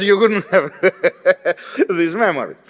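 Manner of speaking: laughing through the words; amused, breaking into laughter mid-sentence. Real, not acted. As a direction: crack up at your own joke. Joking about local salaries, laughs.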